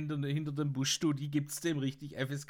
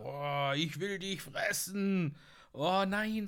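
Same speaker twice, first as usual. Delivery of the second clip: brüllender Wolf; 4 semitones higher, slower than usual. Retold or imitated.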